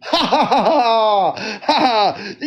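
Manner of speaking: exaggerated jeering laugh; mock laughter. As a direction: fake a gloating laugh. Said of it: "I'm laughing".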